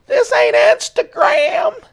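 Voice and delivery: high, slow, shouted declaration; playful shout. Real, not acted. Vlogger contrasting Instagram with his channel, shouting playfully. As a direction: belt it out like a rallying cry. Mock-defiant exclamation to viewers.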